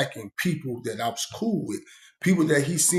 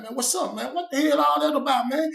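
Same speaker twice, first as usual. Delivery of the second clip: higher, puzzled asking; concerned question; 12 semitones higher than usual. Retold or imitated. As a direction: ask what happened, up and worried. Asking the crying young dude.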